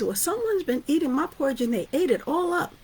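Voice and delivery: high, whiny little bear voice; Baby Bear. Acted. Teacher voicing Baby Bear finding empty bowl, high and whiny. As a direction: complain like a small upset cub.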